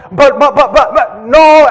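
high, loud stammered protest; pleading kid. Retold or imitated. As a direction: beg with frantic stammering. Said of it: "kid pleading".

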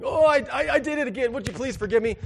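hammed-up pleading brother, stammering; whiny brother. Brother back for forgiveness again.

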